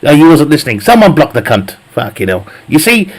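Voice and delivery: usual speaking voice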